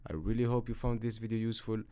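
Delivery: ordinary talk, nothing marked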